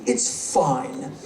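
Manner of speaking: normal talking voice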